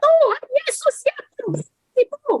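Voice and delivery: very high, strained mock yell; mock shrieked blessing. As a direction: scream the blessing while annoyed. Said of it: "saya teriak". (teriakan berkat yang jengkel)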